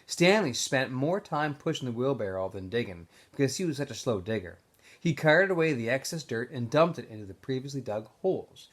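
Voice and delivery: ordinary delivery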